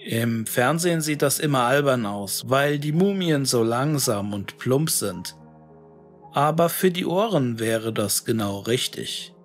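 ordinary delivery

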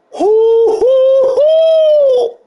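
high, loud celebratory hoots; hooting. Real, not acted. Gamer hooting loudly over a big play. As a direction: hoot like you just won.

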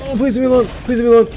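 high, loud frightened plea; scared pleading. Real, not acted. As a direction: beg the creature to go. Pleading with sea creature.

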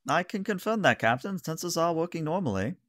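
high-pitched officer reporting to the captain; high, put-on crew report; ship's officer. Game master answers as officer.

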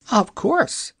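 high hen voice, half yawning; a hen just waking up; sleepy hen. Acted. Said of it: "answered the hen, fluttering her wings and yawning".

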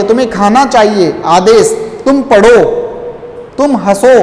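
usual speaking voice

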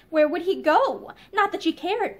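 plain reading voice

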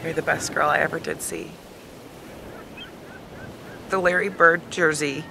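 normal talking voice

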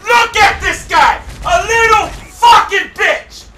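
high, loud, drawn-out rage; furious shouting. Real, not acted. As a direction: yell slowly in real fury at an opponent. Gamer rages at opponent.

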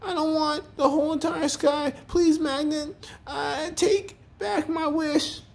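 very high, tearful pleading; panicked shriek. A narrator's reading. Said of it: "he shrieked".

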